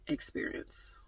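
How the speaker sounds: whispered. Pretend. Mock trauma whispered.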